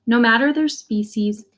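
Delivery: ordinary delivery, nothing marked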